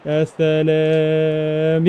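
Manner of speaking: a very slow, long, low يا سلام; drawn out. Real, not acted. Gamer savouring a goal on his stream, long drawn-out يا سلام.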